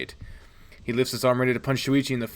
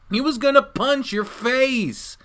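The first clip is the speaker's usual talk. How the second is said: much higher, louder, slow plea; defensive whine; 12 semitones higher, 3 dB louder, slower than usual. Acted. Protagonist defends himself to Shuichi, high defensive plea. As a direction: protest that you only meant well.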